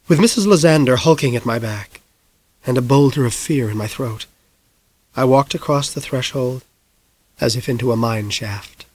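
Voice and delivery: plain reading voice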